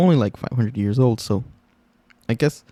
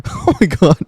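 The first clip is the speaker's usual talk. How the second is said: laughing; 11 semitones higher than usual. Real, not acted. Still laughing at clip.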